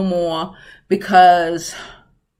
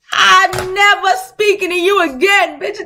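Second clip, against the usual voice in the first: higher, louder cutoff; mock dismissal; 9 semitones higher, 5 dB louder than usual. Telling the Sagittarius girl off, raised and loud. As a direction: slam the door on her.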